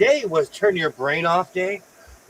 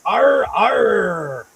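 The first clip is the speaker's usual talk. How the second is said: pirate growl; 4 semitones higher, 5 dB louder than usual. Pretend. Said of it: "I do call myself a pirate".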